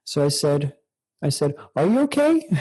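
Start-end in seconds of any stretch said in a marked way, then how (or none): 1.7-2.5 retold question leaping high and louder; concerned check-in. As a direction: imitate a sudden, high-pitched double-check. Retells his concerned question to her.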